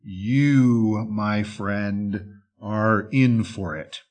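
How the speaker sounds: livid wolf, low and controlled; low menacing rumble. Acted. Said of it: "in a low and menacing rumble".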